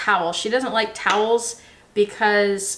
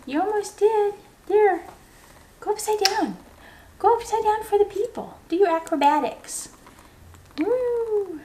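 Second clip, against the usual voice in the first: much higher, delighted sing-song pet voice; pet talk; 9 semitones higher than usual. Real, not acted. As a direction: cheer the bird on like a proud owner. Cooing at her parrot.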